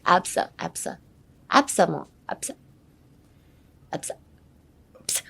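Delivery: ABSA as a quick light sneeze; like a little sneeze. Shown as a demonstration. Teacher isolating the first syllables, ABSA like a sneeze. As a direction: let ABSA puff out with a barely voiced "sa". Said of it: "it's like a little sneeze".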